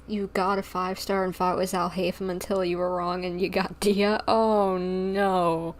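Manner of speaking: ordinary talk, nothing marked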